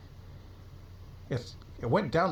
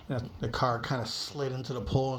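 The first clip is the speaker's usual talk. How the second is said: ordinary delivery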